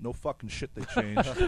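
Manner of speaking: sarcastic, rising to a high squeak. Real, not acted. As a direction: scoff with sarcastic exasperation. Sarcastic retort to fan.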